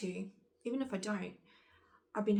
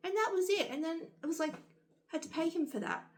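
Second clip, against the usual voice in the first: high-pitched disbelief; exasperated; 8 semitones higher than usual. Real, not acted. Vlogger venting about the doctor's bill, exasperated. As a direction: say it higher, fed up.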